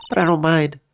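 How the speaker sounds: lightly voiced plucky young woman. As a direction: lightly play a luckless but hopeful girl. Voices Maggie's dialogue.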